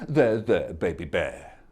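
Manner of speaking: low, soft comforting voice; soothing. Acted. Mama Bear comforting Baby Bear, soft soothing. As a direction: soothe the cub gently. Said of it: "And Mama Bear said".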